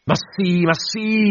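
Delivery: very high, drawn cry; frenzied captain's cry. A narrator's reading. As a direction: wail the words out at a high pitch.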